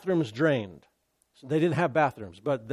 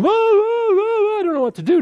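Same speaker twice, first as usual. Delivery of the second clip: much higher, fast, frantic mock panic; panicked mock whoa; 3 dB louder than usual. Preacher mocking a panicking Paul on the ship, frantic and high. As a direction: ham up a flustered Paul losing it. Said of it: "and going".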